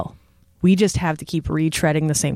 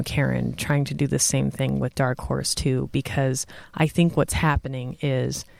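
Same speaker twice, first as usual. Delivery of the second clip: usual speaking voice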